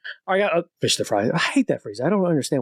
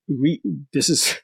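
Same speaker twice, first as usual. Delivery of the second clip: ordinary delivery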